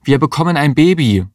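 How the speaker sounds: high, excited announcement; acted high-pitched exclamation; urgent call. A narrator's reading. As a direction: cry it out urgently. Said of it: "rief Mario".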